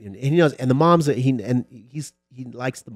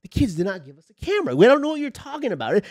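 raised, louder back-and-forth denial; mock street argument; 12 semitones higher, 7 dB louder than usual. Cops accusing gangsters, raised heated argument. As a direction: push back hard, voice up.

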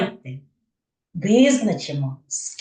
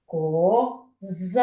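коЗА with a long, rising ЗА; ко... ЗААА, second syllable stretched; stress on second syllable. Shown as a demonstration. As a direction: draw out the last syllable of коза to show the stress. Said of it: "голосніше вимовляю".

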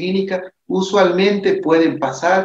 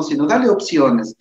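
ordinary talk

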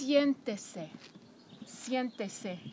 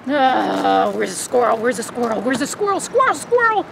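louder teasing questions; playful hype; 9 dB louder than usual. Real, not acted. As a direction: wind the dog up like a game. Teasing dog about squirrel.

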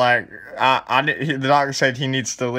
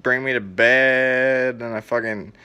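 usual speaking voice

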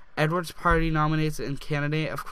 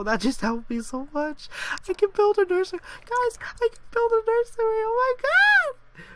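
much higher, louder squealing with joy; excited squeal; 5 dB louder than usual. Real, not acted. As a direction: squeal it out like you just won. Thrilled over game money.

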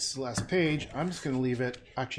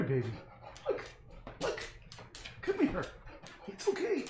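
much higher, softer cooing to a pet; 8 semitones higher, 4 dB quieter than usual. Real, not acted. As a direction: coax the puppy up sweetly and gently. Coaxing the scared dog again.

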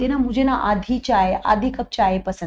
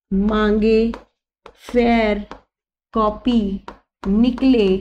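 slow, each word set apart; dictating a mnemonic to the class; slower than usual. Shown as a demonstration. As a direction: dictate one word at a time. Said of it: "मैं बहुत आराम से बोल रही हूँ".

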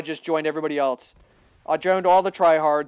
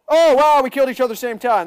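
high, loud, then fast; surprised; 7 semitones higher, 5 dB louder, faster than usual. Real, not acted. Gamer after a simultaneous kill, high fast exclaim. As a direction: blurt it out in amazement.

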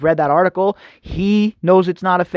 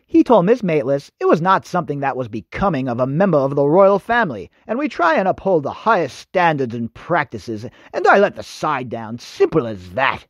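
low, hammed-up bad English accent; terrible English accent; 4 semitones lower than usual. American host mocking the prince's statement, terrible English accent. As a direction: badly imitate a stuffy English royal. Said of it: "I had to break out my terrible English accent".

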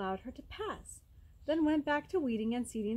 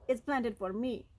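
ordinary delivery, nothing marked

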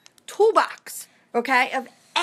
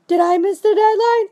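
higher, louder panicky customer; mock panic rising; 8 semitones higher, 5 dB louder than usual. Customer calls the seller again, now louder and panicking. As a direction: ask it again with growing panic. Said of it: "you're gonna get calls saying".

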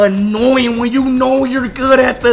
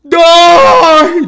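short crying shout; anguished yell; 5 semitones higher, 5 dB louder than usual. Real, not acted. Streamer yells at the game as he dies. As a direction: yell it as your character dies.

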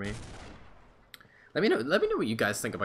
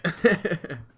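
high embarrassed laugh; 8 semitones higher, 6 dB louder than usual. Real, not acted. Embarrassed after being caught.

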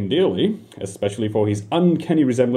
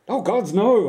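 nervous reply; 11 semitones higher than usual. Acted. Panicked reply to God.

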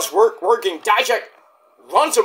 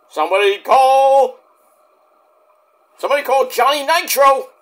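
mock call-out; 6 semitones higher, 3 dB louder than usual. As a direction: call it out as if summoning someone. Mock announcer cry mid-recap.

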